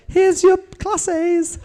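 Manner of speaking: high, slow, proud presentation; mock proud handover. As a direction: hand it over like a prize. Handing over the bag proudly.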